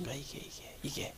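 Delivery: sudden hushed whisper. Real, not acted. Preacher whispering to the congregation about crooked hearts. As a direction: drop it to a breath for effect.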